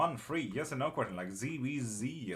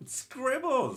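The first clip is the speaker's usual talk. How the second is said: much higher, louder happy call; excited greeting; 6 dB louder than usual. Real, not acted. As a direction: call out the name with delight. Greets a viewer by name.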